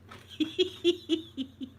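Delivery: high-pitched giggling laugh; giggle. Real, not acted. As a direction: giggle in a quick string. Real laughter after the ouch.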